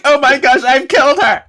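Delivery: high, loud melodramatic cry; overdramatic mock panic. Playing C K after Piper collapses, overdramatic mock panic. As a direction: shriek it like a hammy heroine. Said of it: "Yes, very dramatic".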